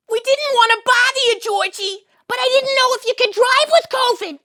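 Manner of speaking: very high, loud shrill mother voice; yelling Estelle. Acted. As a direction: scream down the phone at your son. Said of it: "you can hear them yelling".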